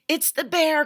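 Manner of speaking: high, loud panicked cry; frightened cry. A narrator's reading. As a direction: Eddie cries out to Mom about the bear, high and loud. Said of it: "cried Eddie".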